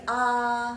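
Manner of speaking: "A" drawn out level; flat first tone. Shown as a demonstration. Teacher contrasts tones on A, level first tone. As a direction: hold "A" long on one steady note. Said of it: "datar dia di atas dia".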